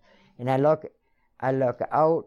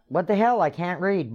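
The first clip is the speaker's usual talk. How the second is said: exasperated outburst; 7 semitones higher than usual. Retold or imitated. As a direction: blurt it out in shock. Dictionary left at her door.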